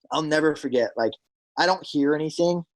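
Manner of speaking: ordinary talk, nothing marked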